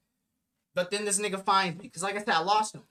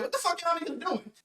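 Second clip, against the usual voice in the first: put-on higher, startled dad shouting at the boys; dad yelling; 8 semitones higher than usual. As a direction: play the shocked father catching the fight. Dad finds fight at door.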